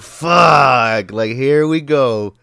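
loud, drawn-out dread; exasperated groan. Retold or imitated. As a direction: curse it loudly, then slow resignation. Dreading sickness returning.

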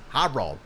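"Harold" with a broad ah in the first syllable; Spanish pronunciation. Shown as a demonstration. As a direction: say "Harold" as "HAH-rold", open A. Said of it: "they're saying it like this".

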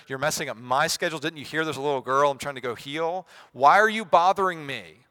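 hammed-up annoyance, building to loud; mock scolding. Imagined Jesus complaining at the woman, mock annoyed. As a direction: scold her, peaking on the last question.

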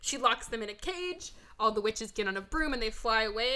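usual speaking voice

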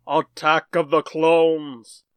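very slow, drawn-out announcer call; fighting game announcer. Acted. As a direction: stretch every word like a ring announcer. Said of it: "do the intro and the announcer voice".

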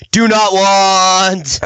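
slow, high, loud drawn-out "Do not want"; drawn-out Vader wail. Retold or imitated. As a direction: wail it long and slow like a bad Vader dub.

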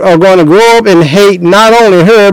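normal talking voice

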